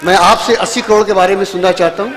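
ordinary talk

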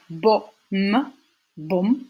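"bom" with a brief, short O. Shown as a demonstration. Teacher giving an example of short sounds, vowel kept brief. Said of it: "il y a les sons courts".

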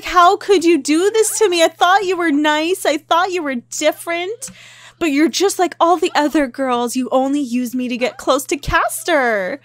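high, loud wounded protest; upset Kevin. Acted. Kevin confronts cheating girlfriend, upset and accusing. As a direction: act out a hurt, betrayed outburst. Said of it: "he's so upset. He's like".

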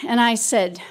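normal talking voice

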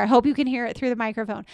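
ordinary talk, nothing marked

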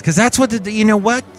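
high, insistent pep talk; suddenly perked up. Real, not acted. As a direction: lift the voice into bouncy enthusiasm. Perks up after told to loosen up.

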